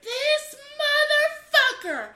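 outraged sister in the car, loud rant; retold furious rant. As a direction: play the fuming sister venting.

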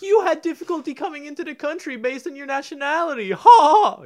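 high, loud jeering voice; mock taunting. Mocking friends acted out, nelson laugh.

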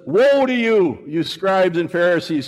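high, loud preaching rebuke; Jesus condemning the religious leaders, loud. Retold or imitated. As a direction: thunder it at the Pharisees.

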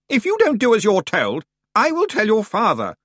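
put-on a prim aunt scolding a boy; threatening aunt. A narrator's reading.

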